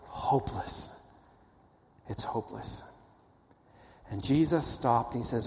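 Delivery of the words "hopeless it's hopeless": whispered, barely audible hopelessness; hushed despair. Acted. Preacher voicing the disciples' despair, whispered. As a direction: let the word drop to a breathless whisper.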